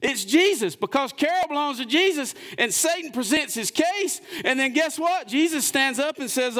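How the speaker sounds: high, ringing preacher's cry; preaching at full force. Acted. Pastor preaching the trial story to the congregation, ringing high. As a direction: lift the story up to the whole church.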